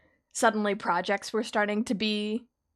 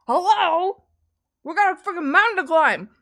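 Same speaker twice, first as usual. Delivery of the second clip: loud, high exasperated call; shouting; 11 semitones higher, 10 dB louder than usual. Retold or imitated. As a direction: yell it to rally the team. Imitating Scrub shouting.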